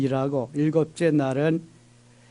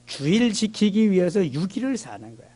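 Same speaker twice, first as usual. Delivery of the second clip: usual speaking voice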